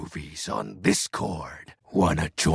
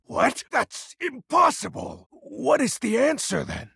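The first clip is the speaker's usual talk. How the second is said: sharply higher, incredulous; can't believe what you just heard; shocked disbelief. Acted. Shocked at Penumbra's answer.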